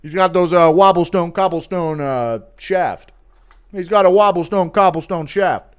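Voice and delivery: lightly voiced hype coach; a touch of mock hype; Lucas. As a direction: imitate an admiring coach. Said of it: "Lucas catching McGregor".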